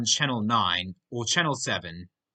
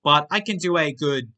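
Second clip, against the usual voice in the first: ordinary talk, nothing marked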